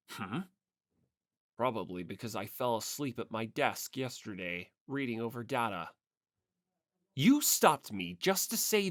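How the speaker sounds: put-on low, haughty rich boy; cold aloof heir. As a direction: brush him off with contempt. Togami voiced by reader.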